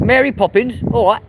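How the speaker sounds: high-pitched Cockney; Cockney accent. Shown as a demonstration. Londoner saying Mary Poppins on request in a high Cockney accent. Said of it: "Poppins with a Cockney accent?".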